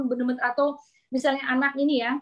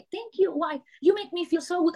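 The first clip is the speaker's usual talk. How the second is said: put-on grateful child; 5 semitones higher than usual. Little child thanking doctor.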